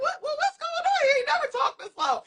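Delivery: very high, squeaky incredulous questioning; mock bafflement. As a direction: act baffled, pitch way up. Acted bafflement at friend's low talk.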